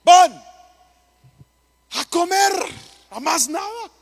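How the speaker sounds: high-pitched punchline, dragged out; comic punchline. Acted. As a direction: pastor delivers the joke to the congregation, high and teasing. Punchline answer to congregation.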